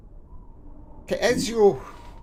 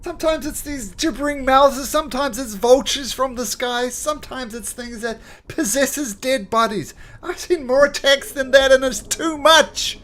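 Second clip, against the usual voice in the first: high, loud desperate outpouring; distraught soldier; 9 dB louder than usual. Acted. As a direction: near tears, overwhelmed. Soldier pours out despair.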